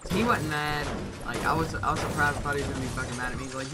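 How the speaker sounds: normal talking voice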